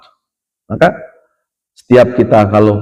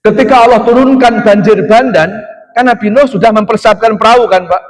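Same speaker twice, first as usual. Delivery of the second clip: fired up; 9 semitones higher than usual. Real, not acted. Resumes flood story with fervour.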